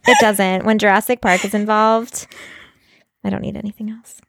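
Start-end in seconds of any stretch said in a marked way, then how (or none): 3.2-4.2 dramatic whisper. Pretend. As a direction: whisper it for effect. Mock dramatic line.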